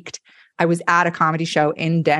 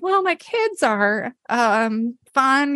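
forced chipper comic voice; 7 semitones higher, much slower than usual. Retold or imitated. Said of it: "heartbroken and been like".

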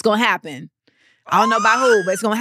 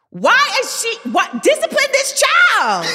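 much higher, louder, slow outraged cry; outraged shriek; 12 semitones higher, 4 dB louder, slower than usual. Retold or imitated. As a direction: shriek it in disbelief at the child. Outraged reaction to rude child.

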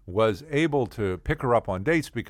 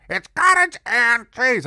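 put-on "cottage and cheese" high and loud in an old lady's voice; Mama Jerry; 13 semitones higher, 9 dB louder than usual. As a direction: insist on "cottage and cheese" as a deaf old landlady. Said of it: "And she talked like this".

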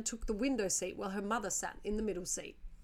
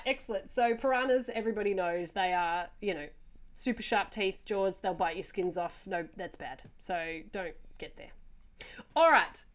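ordinary delivery

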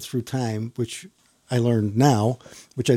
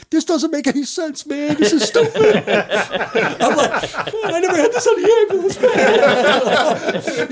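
high, louder laughing mock whine; 5 dB louder, slower than usual. Rookie complains to the officer about the heat, laughing and whining high.

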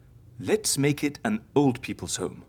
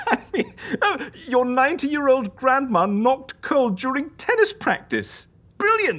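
high giggly delight; laughing; 8 semitones higher, 3 dB louder than usual. Acted. The friend laughs to Raven about absurd deaths, giggling. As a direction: cackle through the list of deaths.

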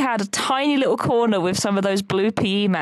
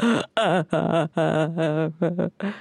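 sobbing gasp; 2 semitones lower than usual. Retold or imitated. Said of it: "And I'm just like".

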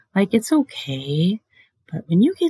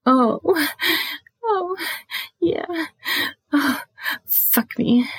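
mock yelled moans; 11 semitones higher, slower than usual. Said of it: "they yell out".

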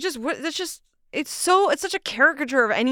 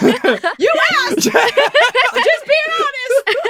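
high, loud retort through laughter; laughing protest; 12 semitones higher, 6 dB louder, much slower than usual. Real, not acted. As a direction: guest defends her crude joke to the host, laughing hard. Teasing retort amid shared laughter.

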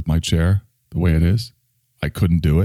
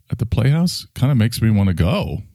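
ordinary talk

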